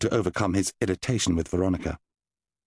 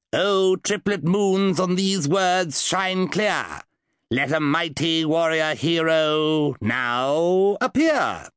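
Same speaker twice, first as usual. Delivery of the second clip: grand incantation; 6 semitones higher, much slower than usual. Acted. Wizard finishing the summoning spell, slow and grand. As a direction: ring out the final summoning words. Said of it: "recite the incantation".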